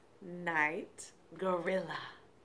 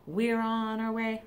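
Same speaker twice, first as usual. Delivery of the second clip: ordinary delivery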